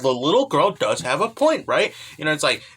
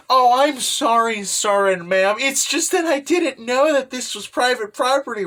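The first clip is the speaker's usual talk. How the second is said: mock polite apology; 7 semitones higher than usual. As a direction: play innocent and overly polite. Kaguya feigns innocent apology.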